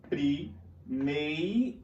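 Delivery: "primeiro" bem lento, alongando o "ei"; sílabas separadas. Shown as a demonstration. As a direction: silabe "primeiro" e repita o ditongo "ei". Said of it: "um exemplo perfeito de um ditongo". ("primeiro" silabado, com "ei" prolongado)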